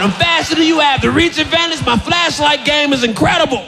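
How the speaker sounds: high, shouted smug brag; mock boasting guard; cocky boast. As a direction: gloat like a champion.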